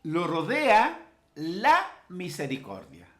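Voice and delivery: high, emphatic pulpit reading; forceful preacher quoting the psalm; proclaiming scripture. Acted. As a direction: declaim the verse in measured phrases.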